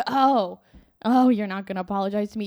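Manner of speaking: loud, mock-indignant superiority. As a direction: act affronted and righteous, quick and loud. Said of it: "holier than thou. I'm like".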